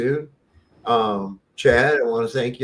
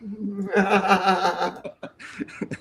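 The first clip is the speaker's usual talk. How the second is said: long high burst of laughter; real laugh; 9 semitones higher than usual. Real, not acted. Host reacts to a guest's joke, real laughter.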